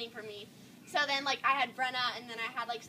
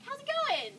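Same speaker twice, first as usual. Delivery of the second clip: high-pitched mock-cheery hello; sing-song high, falsely friendly; fake-friendly greeting; 13 semitones higher, slower than usual. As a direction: chirp it at people caught gossiping.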